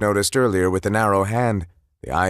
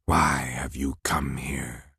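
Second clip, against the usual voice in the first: slow, low whispered threat; menacing whisper; 7 semitones lower, 6 dB quieter, slower than usual. Acted. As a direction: whisper it like a cold king. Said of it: "Laufey asked rhetorically".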